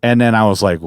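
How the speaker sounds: normal talking voice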